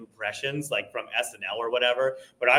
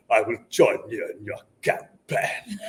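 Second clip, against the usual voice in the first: Ian McKellen impression; 5 semitones lower than usual. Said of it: "I would just always go".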